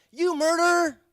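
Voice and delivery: high, loud accusing yell; yelled accusation. Retold or imitated. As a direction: shout it at him, pointing. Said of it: "and yells".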